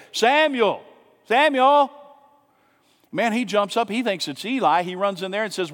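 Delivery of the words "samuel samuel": high, loud calling of a name in the night. Retold or imitated. Voice of God calls sleeping boy, loud and high. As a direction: call out as a voice from nowhere. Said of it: "he hears this voice say".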